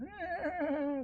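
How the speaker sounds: high squealing creature gurgle; Murloc gurgle. Pretend. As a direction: squeal like a little fish monster. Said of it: "that's how Murlocs sound".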